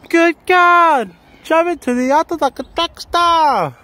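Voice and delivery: high loud shout. Real, not acted. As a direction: belt it out full volume. Shouting song lines in the maze.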